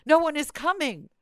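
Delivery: high, loud mock complaint from an aged mother; mother's high-pitched grievance on the phone; whiny complaining mother. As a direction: play the indignant mother calling again.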